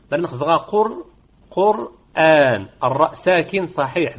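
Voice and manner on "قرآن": قرآن very slow, madd kept short; short madd demo. Shown as a demonstration. Teacher recites the example slowly. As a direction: draw قرآن out slowly with a two-beat madd. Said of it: "لا يجوز فيه إلا القصر".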